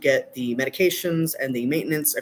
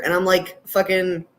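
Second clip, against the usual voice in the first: usual speaking voice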